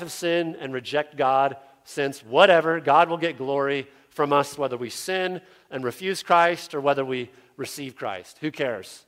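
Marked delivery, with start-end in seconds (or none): none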